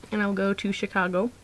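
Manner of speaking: normal talking voice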